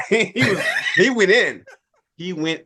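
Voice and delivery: laughing. Real, not acted. Laughing at cousin's outburst.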